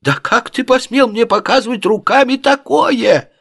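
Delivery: very high, hammed-up indignant howl; wailing outrage. A narrator's reading. Offended camel driver calling his fellow caravan men, wailing in outrage. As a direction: summon everyone with high-pitched, scandalised cries. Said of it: "сбежались на вопли товарища".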